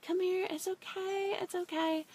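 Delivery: high, soft, slow reassuring voice; calm a frightened kid down gently; super calm. Retold or imitated. Dad gently calming the boy with the hurt eye, soft and slow. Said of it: "talking to my son so nicely".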